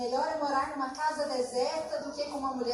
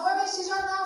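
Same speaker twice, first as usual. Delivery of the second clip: quick, louder offhand husband; husband's casual line; 6 semitones higher, 7 dB louder, faster than usual. Retold or imitated. Husband telling wife to go watch the news, quick and offhand. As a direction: toss it off like the unbothered husband. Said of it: "ele falou".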